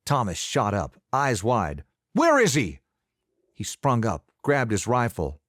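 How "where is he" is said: high, loud furious demand; angry demand. A narrator's reading. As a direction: bark it as you jump up. Thomas springs up enraged.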